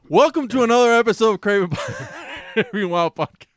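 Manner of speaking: loud, fast, high show opener; announcer-style welcome dissolving into laughter; podcast intro. Pretend. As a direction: belt out a mock intro, cracking up midway. Performs the podcast intro, breaks laughing.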